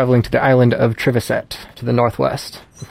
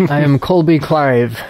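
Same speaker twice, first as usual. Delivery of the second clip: usual speaking voice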